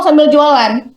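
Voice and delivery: ordinary talk, nothing marked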